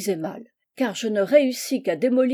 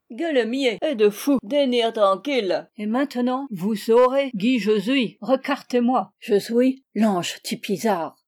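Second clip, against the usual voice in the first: put-on pompous accented angel; 3 semitones higher, 3 dB louder than usual. Monster revealing itself to the stunned host, pompous creature. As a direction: draw out the mangled French grandly.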